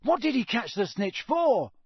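high-pitched exasperated cry; Ron bellowing. A narrator's reading. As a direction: bellow it in disbelief. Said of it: "Ron bellowed".

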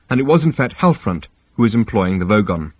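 plain reading voice

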